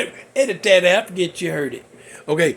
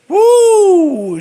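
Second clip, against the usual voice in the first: high, loud woo of delight; excited whoop; 12 dB louder than usual. Real, not acted. Fan gushing about a beautiful actress, high whoop. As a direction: let out a thrilled whoop.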